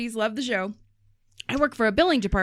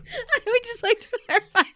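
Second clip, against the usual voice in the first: high giggly voice; high and giggling; laughing; 14 semitones higher than usual. Real, not acted. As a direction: laugh through the words. Laughing correction about karate.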